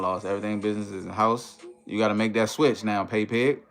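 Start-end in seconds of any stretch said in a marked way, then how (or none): none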